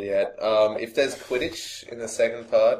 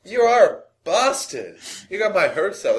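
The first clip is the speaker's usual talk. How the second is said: high, loud, playful indignation; hammed-up accusation, pitched way up; mock outrage; 10 semitones higher, 5 dB louder than usual. Podcaster tricked by co-host's made-up video, mock-outraged.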